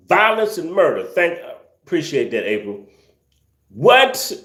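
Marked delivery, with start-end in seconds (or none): 3.7-4.3 high, rising yelp of disbelief; incredulous. Real, not acted. Streamer reacting to sandwich violence and murder, incredulous. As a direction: ask it high, utterly baffled.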